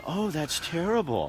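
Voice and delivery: soft, slow mock sympathy; fake sympathy. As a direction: fake concern, drawn out and soft. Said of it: "She's like, going".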